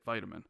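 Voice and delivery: "vitamin" with a long i, VY-tuh-min; low, matter-of-fact "vitamin" with first syllable "vie"; American pronunciation. Shown as a demonstration. Co-host telling host how we say it, "vitamin" with long i. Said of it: "how we say it".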